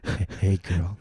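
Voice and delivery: low creepy come-on; hey girl, low and creepy. Shown as a demonstration. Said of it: "Let me do my creepy voice".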